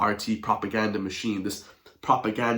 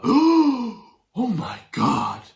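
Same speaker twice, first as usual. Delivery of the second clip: much higher, very drawn-out "Oh my God"; stretched, high mock outrage; mock horror; 12 semitones higher, 5 dB louder, much slower than usual. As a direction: ham up the presenter's shock. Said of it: "by the R T presenter".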